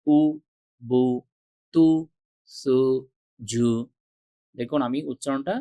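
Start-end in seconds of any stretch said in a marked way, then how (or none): 0.1-3.7 উ বু তু সু জু each clipped short and quick; quick short vowels. Shown as a demonstration. Teacher showing students quick short পেশ sounds. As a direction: snap each syllable off quickly. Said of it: "উচ্চারণটা খুব তাড়াতাড়ি করছি".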